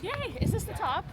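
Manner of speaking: high, bright cheer; excited. Pretend. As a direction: cheer as if the top is reached. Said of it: "Just kidding".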